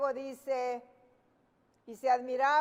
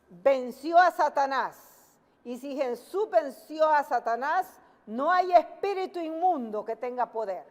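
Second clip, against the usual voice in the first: louder, emphatic preaching; 5 dB louder than usual. Real, not acted. Preacher announcing the theme to listeners, forceful preaching. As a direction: proclaim it to the congregation.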